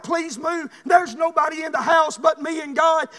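usual speaking voice

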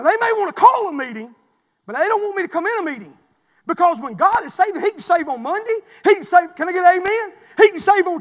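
loud, high preacher's cry; full-force preaching. Acted. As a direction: build to a rousing preach with a laugh.

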